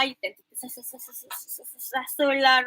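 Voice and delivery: sasa-sasa-sasa blurred together fast; comic imitation of rushed speech; mock rushed mumble. Shown as a demonstration. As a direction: gabble it like a rushing student. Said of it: "you tend to be very fast lah".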